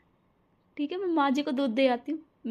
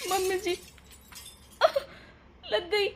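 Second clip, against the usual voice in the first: higher, softer hurt whimper; pained cry; 4 semitones higher, 4 dB quieter than usual. Acted. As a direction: cry out as if just pushed over. Bride hurt after being pushed.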